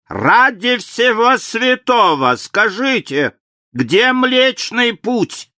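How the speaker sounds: громко, высоко и медленно, в отчаянии; выкрикни, тряся отца; отчаянный крик. A narrator's reading. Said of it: "тряхнул и крикнул". (отчаянный крик)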